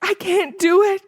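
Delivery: high, loud desperate plea; boy crying out to his teacher; frightened cry. A narrator's reading. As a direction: beg in panic. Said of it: "I cried".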